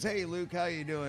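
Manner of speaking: usual speaking voice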